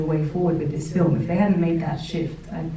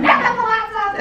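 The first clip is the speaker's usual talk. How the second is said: much higher, louder comic cry of despair; mock anguished wail; 5 dB louder than usual. Barbie watching her clothes get thrown, hammed-up louder, much higher wail. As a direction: wail it like losing your dearest possession. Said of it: "massive meltdowns".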